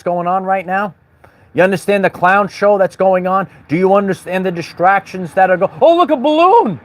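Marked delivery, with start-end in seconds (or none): 5.8-6.8 sudden high mock gasp at a balloon; mock surprise. Mocking the crowd, feigns spotting a balloon, surprised. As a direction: play at being distracted, up high and bright. Mock distraction mid-sentence.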